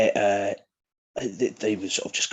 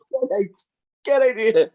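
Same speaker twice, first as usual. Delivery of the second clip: loud, high-pitched disbelief; high, loud, amused disbelief; incredulous outburst; 13 semitones higher, 8 dB louder than usual. Real, not acted. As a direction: burst out as if you can't believe it. Incredulous reaction to the story.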